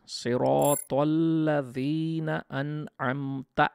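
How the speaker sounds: slow, drawn-out recitation; slow tajweed recitation; Quran recitation. Shown as a demonstration. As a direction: recite the verse slowly and melodically. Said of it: "ঠিক তারপরের আয়াতেই আবার দেখছি".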